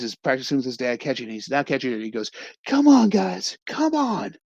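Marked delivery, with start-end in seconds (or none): 2.7-4.4 high, loud pleading yell; yelling at his hands. Retold or imitated. Boy yelling at his hands, high loud frustration. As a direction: beg your own hands to work, shouting up high. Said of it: "yelling at his hands".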